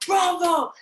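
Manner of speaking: high, loud cheering cry; cheering bravo. Retold or imitated. The audience cheering her, loud and high. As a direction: shout it like a thrilled audience. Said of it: "and they were like".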